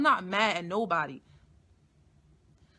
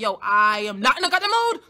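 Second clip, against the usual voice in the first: louder, fed-up quote, level pitch; louder fed-up retort; 2 semitones higher, 8 dB louder than usual. Retold or imitated. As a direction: snap it out, done with everything. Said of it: "And I'm just like".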